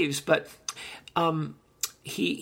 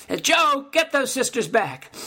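calling out; 9 semitones higher, 5 dB louder than usual. Retold or imitated. Mobster calls his toady.

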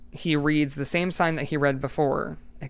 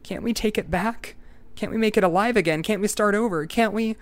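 high, urgent begging; desperate pleading; 6 semitones higher than usual. Acted. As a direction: beg to undo it all. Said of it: "he pleaded".

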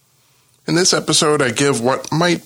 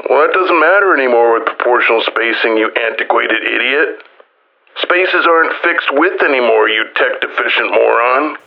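mocking haters' voice; 5 dB louder than usual. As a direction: hurl it like an exaggerated, insulting critic. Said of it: "that's the way it sounds to me".

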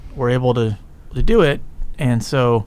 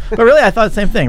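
laughing; 8 semitones higher, 5 dB louder than usual. Real, not acted. Laughing at the high voice.

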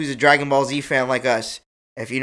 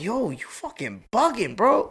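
incredulous; 7 semitones higher, slower than usual. Retold or imitated. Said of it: "And I'm like".

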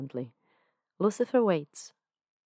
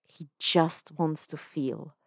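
ordinary delivery

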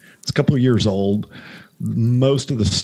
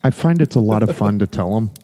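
ordinary delivery

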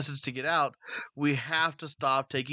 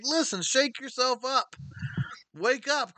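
high-pitched preacher's wake-up call; impassioned, raised-pitch urging; urgent exhortation; 8 semitones higher, slower than usual. Real, not acted. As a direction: plead with them to rouse themselves. Exhorting today's believers to wake up.

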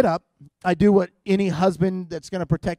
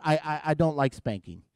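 ordinary talk

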